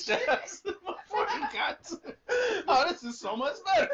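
laughing. Real, not acted. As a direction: laugh through a tease. Mocking friend's learning.

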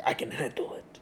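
breathy whispered reassurance. Retold or imitated. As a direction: whisper it, brushing off the wound. Wounded man downplays his injury.

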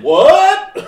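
loud, high rising mock outburst; game's big boss. Big boss storming out at the musician, loudly challenging. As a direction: burst in as an indignant end-of-game boss. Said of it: "when the big boss".

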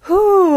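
high, loud exhaled whew. Real, not acted. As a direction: blow out a big, high whew. Shaken by chills from song.

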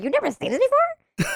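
high, incredulous teasing; mock disbelief. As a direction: tease him in shocked disbelief. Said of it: "I'm not gonna get at him like".

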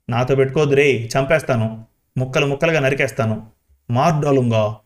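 ordinary delivery

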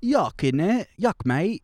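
high, put-on British lad; British slang accent. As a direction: toss it out in a silly British accent. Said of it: "throw, like, a "Yuck, mate".

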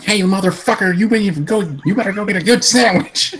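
high, strained tough-guy voice; Brock Lesnar impression. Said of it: "hear Brock Lesnar be like".

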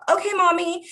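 put-on high, small child's "okay, mommy"; little girl reply. Little girl answering mom.

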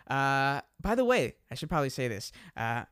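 usual speaking voice

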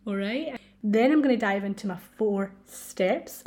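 normal talking voice

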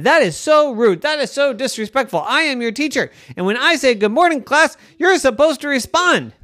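high, huffy scolding; indignant teacher. Acted. Girl scolds pretend class for not answering, indignant. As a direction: play the offended teacher.

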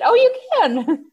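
high reply through a laugh. Retold or imitated. To the puzzled man, laughing. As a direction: giggle out the words.

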